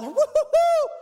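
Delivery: very high, loud whooping cheer; the church whooping as Peter comes in. Acted. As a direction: whoop with joy. Everybody freaks out.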